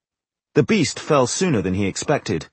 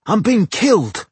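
alarmed cry; 10 semitones higher, 4 dB louder than usual. Acted. Loud cry.

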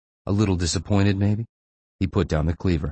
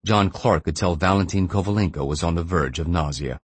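ordinary delivery

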